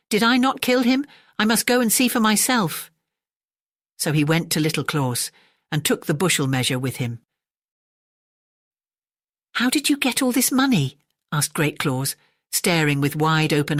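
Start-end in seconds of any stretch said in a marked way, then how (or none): 0.1-2.9 baffled. A narrator's reading. Great Claus baffled. 9.6-11.0 amazed question. A narrator's reading. Staring with wide open eyes.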